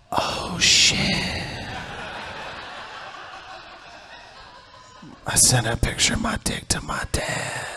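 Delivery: whispered panic, breathy and hushed; horrified whisper. Retold or imitated. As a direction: hiss it out in sudden dread. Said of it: "that's when I was like".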